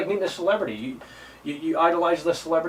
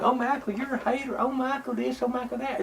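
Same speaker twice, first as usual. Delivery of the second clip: high, soft mocking voice of a hater; mocking whiny critic; 8 semitones higher, 6 dB quieter than usual. Mocking the fans who call him a hater, high mock whine. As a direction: sneer at the fans in a higher, softer voice. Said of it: "who is looking at these videos and saying".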